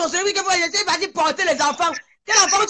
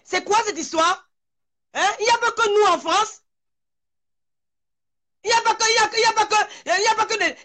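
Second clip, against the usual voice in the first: raised, higher-pitched telling-off; angry scolding; 7 semitones higher than usual. Real, not acted. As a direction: scold them hard, voice pitched up. Woman scolding people on a live.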